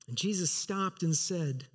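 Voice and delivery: ordinary delivery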